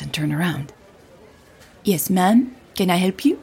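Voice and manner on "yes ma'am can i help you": tired airport worker straining to be polite; lightly voiced polite older woman, questions lifting at the end; forced friendliness. A narrator's reading. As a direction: voice a weary worker's effortful courtesy. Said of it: "taking everything the woman still had in her to be friendly".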